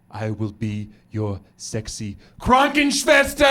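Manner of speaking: low sultry start, sudden harsh German shout; purr, then blast out KRANKENSCHWESTER; slow seduction exploding on Krankenschwester; seductive wife turned shouting. Retold or imitated. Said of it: "she was like".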